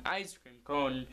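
ordinary delivery

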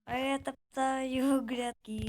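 slow, drawn-out needling; teasing drawl. Real, not acted. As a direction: stretch each word to tease a playmate. Repeats the teasing while trampling. (дражливо, протяжно)

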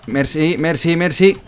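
calling the target out fast and urgent; excited callout. Real, not acted. Gamer spotting the enemy healer, excited callout.

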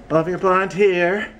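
normal talking voice

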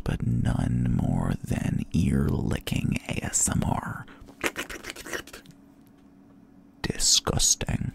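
slow, drawn-out whispered disgust; whispered grossed-out verdict. Real, not acted. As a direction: whisper it slowly with distaste. Whispering disgust at ear-licking ASMR.

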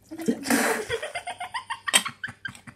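spit take laugh. Real, not acted. Spat drink while laughing.

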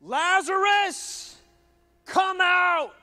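high, loud, drawn-out call; Jesus calling loudly into the tomb; commanding shout. Acted. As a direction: summon him with power. Jesus calls Lazarus again.

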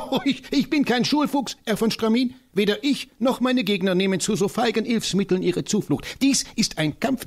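high, fiery voice of the tiny professor; rally for battle with comic zeal; an excitable old scholar, pitched high; eccentric little professor. Acted. Professor scoffs, laughing.

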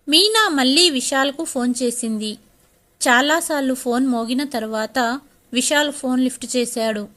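usual speaking voice

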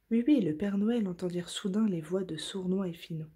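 ordinary delivery, nothing marked

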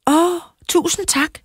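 high, drawn-out mock gratitude; cheeky thanks. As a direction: thank him sweetly to tease him, stretched out high. Child cheekily thanks threatening neighbour.

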